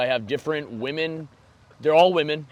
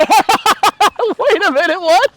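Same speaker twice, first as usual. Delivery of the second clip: much higher and louder, stunned and slow; outraged disbelief; 10 dB louder, slower than usual. Real, not acted. Co-host reacting to the coach's insult, loud disbelieving outcry.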